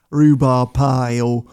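mock-refined British accent. Shown as a demonstration. Showing publications how to sound smarter, British accent. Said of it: "Do it in a British accent".

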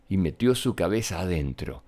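ordinary delivery, nothing marked

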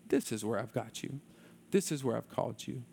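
low, hushed reassurance; God speaking. Retold or imitated. God reassures a young man in a dark hallway, low and gentle. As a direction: softly voice God, low and unhurried. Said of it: "and God saying".

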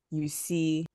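ordinary delivery

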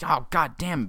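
high, loud exasperated outburst; mock exasperation. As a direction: groan it out as a frustrated onlooker. Acted audience reaction to the upset.

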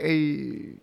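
Fonzie. Acted. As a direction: lightly imitate a TV catchphrase greeting. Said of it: "Not Fonzie".

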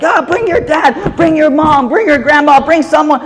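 high, excited pitch on each invitation, pushing hard; eager urging. Retold or imitated. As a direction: excitedly push her to bring anyone at all. Teacher urging student to bring family.

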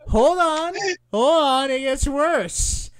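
high, loud plea to wait; urgent calling out. Real, not acted. Reader cuts off friends' groans, urgent calling out.

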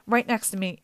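ordinary delivery, nothing marked

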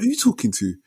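high, challenging "Who you talking to?"; squaring up to the shopkeeper, sharp and high; retold angry comeback. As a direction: fire it back at him, pitch jumping way up. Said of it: "we started shouting at each other".

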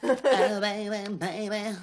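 put-on high breathy baby voice singing. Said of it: "Baby voice [name]".

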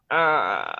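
low, drawn-out "uh" groan; mock grumpy groan. Wife answering husband's good morning, mock grumpy groan.

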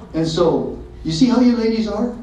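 normal talking voice

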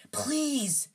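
high, voiced urgent plea; frantic whisper. A narrator's reading. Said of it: "Harry whispered frantically".

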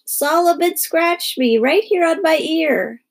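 high, slow wailing complaint; screeching child. A narrator's reading. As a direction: screech the accusation, dragged out. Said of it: "she screeched".

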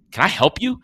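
a big guy snapping at a weirdo staring at him; macho guy challenge. Retold or imitated. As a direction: confront him like a macho stranger. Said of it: "he's just like".

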